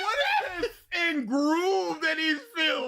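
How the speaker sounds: cracking up while shouting; laughing shout. Real, not acted. Joking over laughter.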